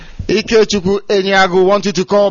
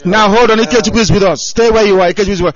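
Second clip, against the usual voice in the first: calling out; 2 semitones higher, 5 dB louder, faster than usual. Real, not acted. Calling to a caller on phone.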